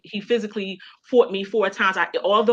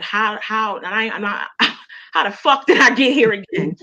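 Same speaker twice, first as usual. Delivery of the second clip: exasperated disbelief; 2 semitones higher, 6 dB louder than usual. Retold or imitated. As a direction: blurt it out, laughing at how absurd it is. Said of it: "I just was sitting there like".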